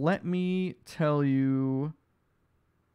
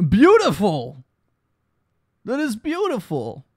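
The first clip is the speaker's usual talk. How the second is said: loud, high, over-the-top mock delight; ironic praise; 6 semitones higher, 6 dB louder than usual. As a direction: gush with fake enthusiasm about a rushed drawing. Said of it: "He says ironically".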